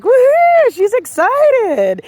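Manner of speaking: high, slow gleeful dog voice; dog voiced as overjoyed by the happy tone; voicing the dog. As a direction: play the thrilled dog. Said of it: "he's like".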